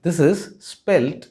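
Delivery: ordinary delivery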